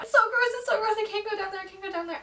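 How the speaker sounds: high panicky whine; freaking out. Retold or imitated. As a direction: panic at the dark water. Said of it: "I was freaking out".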